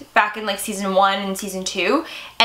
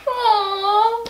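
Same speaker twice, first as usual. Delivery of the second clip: high, cooing aww; touched aww; 11 semitones higher than usual. Real, not acted. Fan touched by son and stepfather moment, cooing. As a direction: coo as if melting at something adorable.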